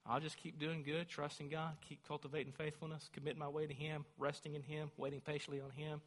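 quiet, calm murmur of trust; soft reflective preaching. Acted. Preacher modelling calm trust, soft and quiet. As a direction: ease the words down gently.

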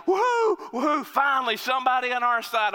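high, loud whooping cheer; right-wing fans cheering the Zealot; cheering shout. Retold or imitated. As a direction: celebrate like winning fans. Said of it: "they start shouting".

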